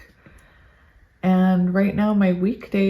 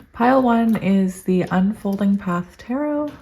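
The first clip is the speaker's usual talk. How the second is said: ordinary delivery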